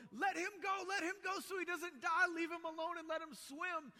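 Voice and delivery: high, loud frantic calls; panicked shouting. Retold or imitated. People on the bank yelling to let him swim, loud frantic shout. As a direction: shout it like a worried bystander. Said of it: "there was people going".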